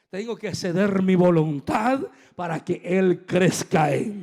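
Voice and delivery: booming, emphatic sermon climax; preach. Real, not acted. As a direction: preach it at full volume, stretching key words.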